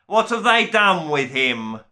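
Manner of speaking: slow, loud demand for his son; bellowing father. A narrator's reading. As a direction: roar it like an outraged duke. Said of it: "a voice bellowed".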